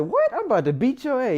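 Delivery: high, sharp burst of indignation; mock threat. The other boy threatening to beat me, mock angry. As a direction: snap back at me with a threat.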